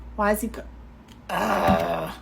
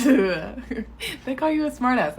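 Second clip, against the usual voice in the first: laughing; much slower than usual. Real, not acted. Laughing at coworker's joke.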